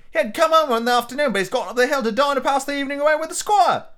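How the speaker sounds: high, hurried maid; maid's voice. A narrator's reading. Maid explaining the doctor's absence, high and quick.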